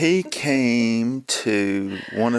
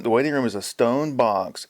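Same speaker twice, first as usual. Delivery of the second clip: ordinary talk, nothing marked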